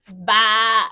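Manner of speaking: loud spoken sheep baa; sheep bleat. Acted. Teacher showing children the sheep's sound, a loud bleat. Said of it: "Goes the sheep".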